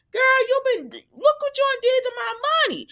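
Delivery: high, loud put-on voice; sassy young woman confronting the guardian; sassy confrontation. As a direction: call her out, sassy and high. Imitating Sasha confronting Gladys.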